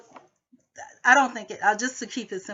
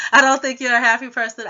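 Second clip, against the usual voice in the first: louder, higher imitation of the housemate; mock loud quote; 4 semitones higher, 12 dB louder than usual. Vlogger loudly imitating the housemate's insult. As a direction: mimic her jab, loud and pitched up. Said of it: "And then she says".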